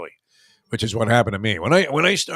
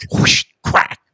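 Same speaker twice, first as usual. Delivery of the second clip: loud whispered whoosh then a sharp crack; imitated swing and crack; 10 dB louder than usual. Mimicking the ball striking something after the swing, whispered whoosh and crack. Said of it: "that quick secondary sound where it goes".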